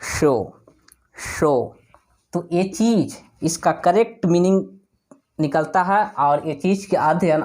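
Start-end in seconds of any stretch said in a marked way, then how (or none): 0.1-1.8 शो with a clear sh; शो as in show; sh sound. Shown as a demonstration. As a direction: say शो with sh, not s. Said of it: "जो pronounce हम लोग इसे करते हैं".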